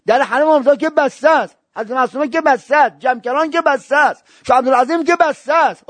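high, loud, impassioned sermon cry; fiery preaching. Acted. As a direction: preach it to the crowd at full force. Preaching at full force.

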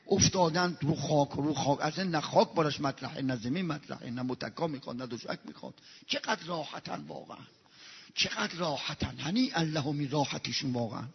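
quiet, moved wonder; tender hush. Acted. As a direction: marvel softly at their ease. Tender description of sleeping pilgrims.

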